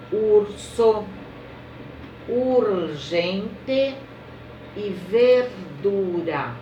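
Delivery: teacher modelling ar er ir or ur words; slow word list reading. Shown as a demonstration. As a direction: read each word slowly to children, syllable by syllable. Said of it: "pronunciado brando".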